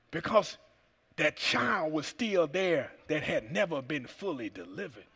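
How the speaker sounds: ordinary delivery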